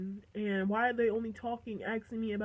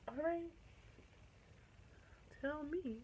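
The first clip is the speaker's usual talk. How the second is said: coy reply; 5 semitones higher, 14 dB quieter than usual. Retold or imitated.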